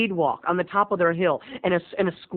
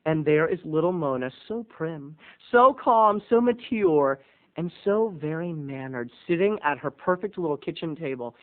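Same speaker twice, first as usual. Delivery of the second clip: normal talking voice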